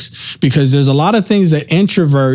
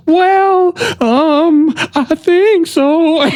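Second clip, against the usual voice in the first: much higher, slow, unsure "I think so"; hesitant answer; 12 semitones higher, much slower than usual. Retold or imitated. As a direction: hedge it slowly as a half joke. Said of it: "I was like".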